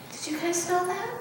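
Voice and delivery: plain reading voice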